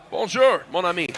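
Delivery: put-on loud, high English-accented French greeting; hammy tourist French; foreign accent in french. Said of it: "trying to speak French".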